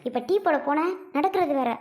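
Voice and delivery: ordinary talk, nothing marked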